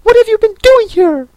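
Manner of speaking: fast, very high, loud demand; shrill rapid interrogation; shrieking Queen. Acted. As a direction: screech the question like a tyrant.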